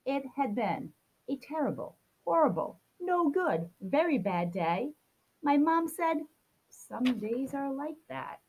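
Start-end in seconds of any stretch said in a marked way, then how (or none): none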